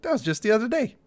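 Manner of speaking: high, soft, airy mock-naive voice; lightly voiced nostalgic dupe; mock clueless. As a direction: play someone who thinks it was yesterday. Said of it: "you're like".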